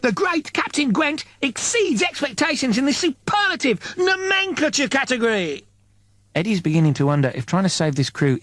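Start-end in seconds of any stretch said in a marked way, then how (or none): 0.0-5.6 high, pompous captain voice; a grandiose high captain declaring; Captain Gwent voice. Acted. Narrator voicing pompous Captain Gwent, high and grand.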